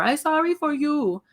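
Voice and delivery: put-on high small-child voice, earnest; a little boy trying to apologize; toddler voice. Mom imitating her son's apology, high childish voice.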